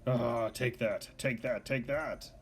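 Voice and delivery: slow, gloating villain lines, lightly voiced; gloating monster servant. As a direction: savor each 'take that' like a brute enjoying the fight.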